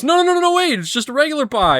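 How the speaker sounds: high, loud cartoon backpedalling; high-pitched put-on protest; hasty denial. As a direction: imitate a flustered cartoon salesman covering up. Acting out seller's hasty denial.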